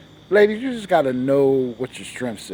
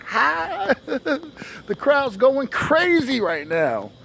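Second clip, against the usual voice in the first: hype host; 10 semitones higher, slower than usual. Acted. Host opens show to imagined crowd.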